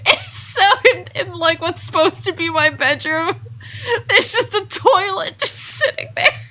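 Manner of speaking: high laughing voice. Real, not acted. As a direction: talk while cracking up. Laughing at toilet in bedroom.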